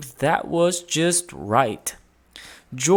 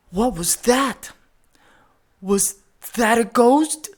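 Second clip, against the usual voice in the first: frightened guest; 8 semitones higher than usual. A narrator's reading. Party guests hearing the crash, frightened. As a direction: ask as if spooked in the dark.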